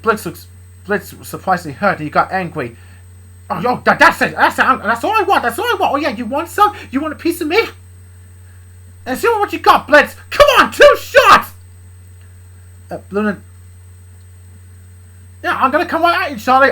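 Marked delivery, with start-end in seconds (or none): 3.5-11.5 high, loud, taunting challenge; furious mocking dare; angry taunt. Blitz angrily taunting, voiced. 15.4-16.7 loud, high threatening boast; angry dad squaring up; angry threat. Acted. Blitz charging, voiced threat.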